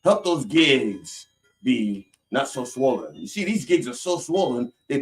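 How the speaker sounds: slow, drawn-out healing plea; mock faith healer. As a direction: stretch the words like a revival preacher.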